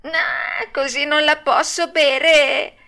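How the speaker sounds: high, slow puppet whine; drawn-out hammed-up disgust; grimacing refusal. A narrator's reading. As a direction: balk at it like a spoilt child. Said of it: "facendo mille smorfie".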